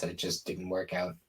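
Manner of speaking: usual speaking voice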